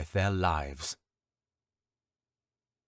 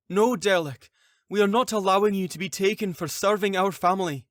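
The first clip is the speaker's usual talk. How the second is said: higher, louder, fast pleading wife; desperate protest; 11 semitones higher, 6 dB louder than usual. Acted. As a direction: rush out the words to stop the arrest. Wife refuses husband's arrest.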